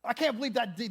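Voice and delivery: hammed-up angry disbelief; mock anger. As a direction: act outraged at what someone did. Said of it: "That's what anger is. We're just like".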